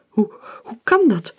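high, stuttering panic; shaken, stuttering disbelief; stammering. A narrator's reading. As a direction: stumble over the words in shock. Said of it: "stotterde Hector".

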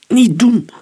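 high, loud urgent plea; urgent calming attempt. A narrator's reading. Omer holding back furious Joseph, loud urgent plea. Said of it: "probeerde Omer hem nog te kalmeren".

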